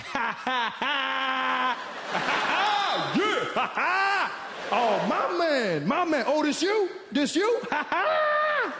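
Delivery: high, loud exaggerated cackling laugh. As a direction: laugh big and brash like a cool guy. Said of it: "I was like".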